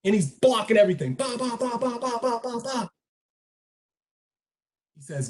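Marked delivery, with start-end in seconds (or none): 1.1-2.9 staccato "ba" for each blow; higher, softer machine-gun sound effect; imitated rapid blows. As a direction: voice a flurry of punches being blocked. Imitates a flurry of blocked blows.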